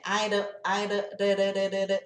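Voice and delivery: I don't reduced to "ai-da", da tapped repeatedly; Brazilian-style tapped R. Shown as a demonstration. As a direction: flick the tongue on each da like Portuguese "ra". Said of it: "igual o nosso". (da batido como o R de "arara")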